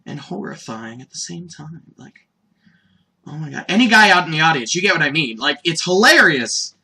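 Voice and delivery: ordinary talk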